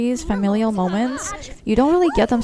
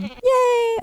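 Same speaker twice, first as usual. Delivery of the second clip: high, squeaky yay; high-pitched happy squeal; delighted cheer. Real, not acted. Cheering at good news in game.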